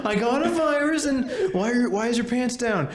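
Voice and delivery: very high squeaky imitation of a clip; high-pitched parody of a viral clip; mock video voice. Quoting a video they love.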